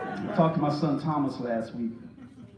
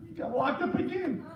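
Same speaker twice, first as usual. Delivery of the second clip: high, softer tearful mother imitation; mock sobbing; 11 semitones higher, 5 dB quieter than usual. Mother telling the preacher about her son, mock sobbing. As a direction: whimper it in pretend tears. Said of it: "So she's crying".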